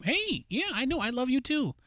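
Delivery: very high, soft cooing to the dog; high pet talk. Real, not acted. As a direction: coo up high like to a puppy.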